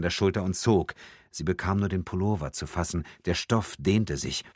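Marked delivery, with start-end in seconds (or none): none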